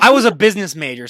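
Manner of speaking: loud, proud mock boast; jokingly boasting about her business major; mock bragging. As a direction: brag it as a joke, puffed up. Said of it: "no, I'm just kidding".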